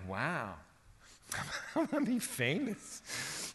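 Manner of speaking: hushed, giggly mock amazement; playfully put-on fame-struck voice, laughing; mock starstruck wonder. Preacher mocking what Mary was not thinking, giggly mock awe. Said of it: "She's not thinking like".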